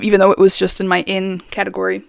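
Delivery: ordinary delivery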